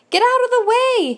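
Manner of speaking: high, loud yell out of a jeep; yelling sheep. A narrator's reading. Sheep in a jeep yells at the stuck duck, loud and high. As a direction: bellow it at the duck blocking the road. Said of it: "he yells with a beep".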